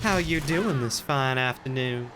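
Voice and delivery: sweet Southern politeness; Southern accent. Acted. Voicing a Southern character.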